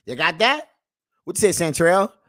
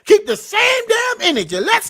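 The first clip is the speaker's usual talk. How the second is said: much higher, loud demand; emphatic shout; 12 semitones higher, 4 dB louder than usual. Real, not acted. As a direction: drive the point home at full volume. Preaching at viewers.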